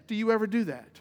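ordinary delivery

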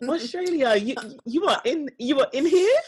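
trying an Australian twang and giving up; mock Australian accent. Australian accent attempted for Australian listeners, laughing. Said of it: "I can't really do your accent".

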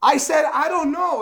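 high-pitched, acted angry denial; exasperated denial. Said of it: "And he curses people".

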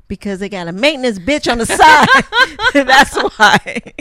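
loud laughing punchline. Real, not acted.

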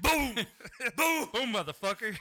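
loud, high, laughing booms; mock door-pounding yells. Joking about knocking, yelling boom at the door. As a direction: bellow each boom like punching the door. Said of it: "We'll start knocking on doors like".

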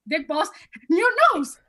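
imitating a flustered friend, pitch climbing. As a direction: climb up to a high, panicky last word. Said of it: "his voice will get higher".

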